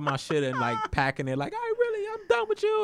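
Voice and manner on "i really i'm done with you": very high mock girlfriend voice; squeaky imitation of her storming off; put-on falsetto, louder, done with him; fed-up girlfriend. Imitating girlfriend packing in a quarrel.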